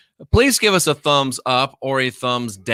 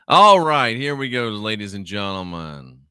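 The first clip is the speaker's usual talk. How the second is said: usual speaking voice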